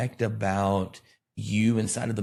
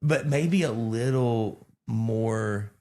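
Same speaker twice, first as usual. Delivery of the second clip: ordinary delivery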